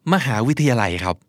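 ordinary delivery

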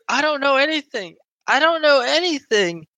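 high, frantic repeating of denial; scared girl. Acted. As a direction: squeak it out like a terrified girl. GM voices scared NPC Teresa.

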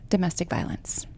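whispered out of gravity; hushed. Real, not acted. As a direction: drop your voice to a near whisper for a grave subject. Hushed on a painful topic.

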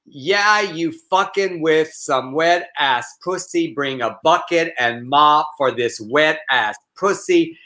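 slow, measured poetry recital; mock-serious poetry reading; read as a poem. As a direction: recite it like a solemn poem, drawing out each phrase. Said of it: "I just read it as a poem".

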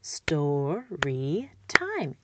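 story time split slowly into syllables; syllables clapped out slowly. Shown as a demonstration. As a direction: break story time into slow beats. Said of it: "and I model".

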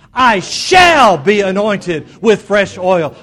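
"shall" stretched long and shouted; stress on SHALL. Shown as a demonstration. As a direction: belt out "shall" high and long, slow declaration. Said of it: "emphasize the word "shall".

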